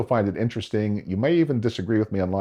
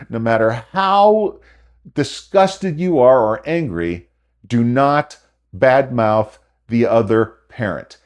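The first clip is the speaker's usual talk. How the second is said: slow, louder, every word hammered; emphatic warning; 3 semitones higher, 4 dB louder, slower than usual. Real, not acted. Advice vlogger warning divorced parents, slow emphatic insistence. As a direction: lay down the law, word by word.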